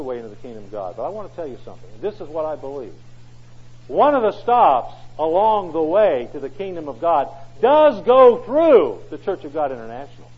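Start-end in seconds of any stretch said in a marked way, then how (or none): 3.9-10.3 much louder, slow proclamation; emphatic preaching. Real, not acted. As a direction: drive the claim home.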